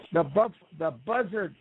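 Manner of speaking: ordinary delivery, nothing marked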